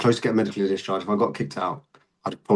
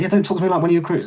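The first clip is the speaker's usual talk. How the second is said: wife quoted; 8 semitones higher, 3 dB louder, faster than usual. Retold or imitated. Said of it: "she was like".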